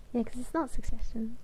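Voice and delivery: ordinary delivery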